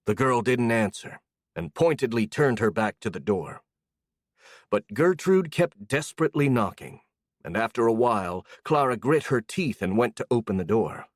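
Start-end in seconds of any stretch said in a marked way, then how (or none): none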